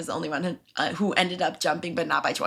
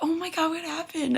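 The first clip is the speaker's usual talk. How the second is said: retold concern; 10 semitones higher than usual. Manager finds her sobbing.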